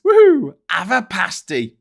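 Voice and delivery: playful cheer from chat; reading out a whoop. Retold or imitated. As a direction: whoop it out for the viewer. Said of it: "says Diz".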